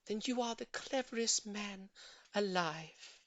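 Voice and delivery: soft, high and fond; tender. A narrator's reading. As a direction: praise him tenderly. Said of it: "touching him softly".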